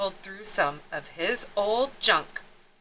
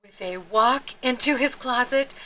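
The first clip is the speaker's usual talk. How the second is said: plain reading voice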